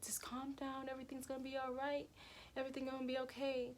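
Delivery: soft, quick, hushed reassurance; calm her down gently; sister's voice soothing me inside my head. Retold or imitated. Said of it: "She's like".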